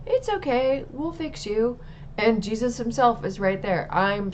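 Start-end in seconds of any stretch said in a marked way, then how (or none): 0.1-1.8 higher, lightly voiced gentle angel; a hint of a soothing angel, pitched up. Angel in the bathtub reassures the narrator, in a lightly raised gentle voice. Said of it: "an angel ... saying".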